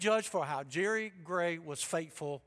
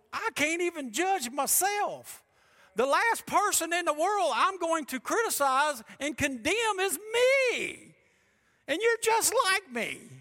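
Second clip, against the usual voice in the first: much higher and louder, climbing to a peak on "is me"; fervent preaching shout; 12 semitones higher, 9 dB louder than usual. Acted. Pastor booming Paul's words at his church, high fervent shout. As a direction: cry it out as Paul, full force.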